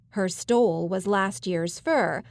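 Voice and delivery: plain reading voice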